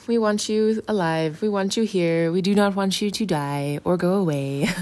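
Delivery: loud, insistent protest; emphatic plea. Retold or imitated. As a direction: plead firmly for someone to stay. Peter pleading, acted retelling.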